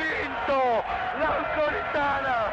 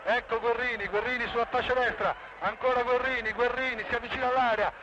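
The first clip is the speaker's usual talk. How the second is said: breathless italian goal commentary; faster than usual. Real, not acted. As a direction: rattle off the action quickly. Live play-by-play continues. (radiocronaca rapida e incalzante)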